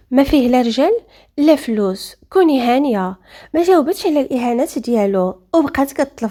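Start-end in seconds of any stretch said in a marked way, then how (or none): none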